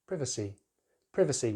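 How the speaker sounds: privacy as PRIV-uh-see, short i; British pronunciation. Shown as a demonstration. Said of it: "with stress on that first syllable".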